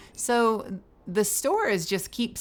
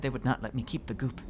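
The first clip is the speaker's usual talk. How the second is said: mock sad Javik; 8 semitones lower, 4 dB quieter than usual. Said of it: "why are you looking so sad".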